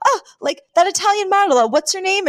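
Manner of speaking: high, eager mock-reporter chatter; imitated excited journalists. Journalists mistaking her for a supermodel, imitated high excitement. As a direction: pitch it high like a curious press pack.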